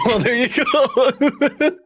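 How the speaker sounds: words squeezed out through high laughter; cracking up mid-sentence; laughing. Real, not acted. Host laughing at Gertrude's piggy startup sound. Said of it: "laughing at the startup sound".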